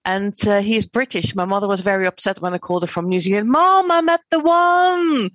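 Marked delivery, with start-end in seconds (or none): 3.5-5.3 high, loud and slow, bursting with news; thrilled announcement. Retold or imitated. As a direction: gush the news to mom like a giddy girl. Excited call home to mother.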